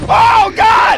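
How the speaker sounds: high loud panicked cry; being chased. Real, not acted. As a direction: cry out in fright. Fleeing the thumper.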